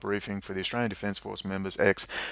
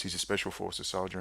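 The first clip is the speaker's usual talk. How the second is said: normal talking voice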